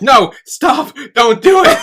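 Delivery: high, loud panicked cry to stop him; alarmed shout. Retold or imitated. Ida yelling at Aoki on the roof, loud and high. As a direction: yell at him to get off the fence.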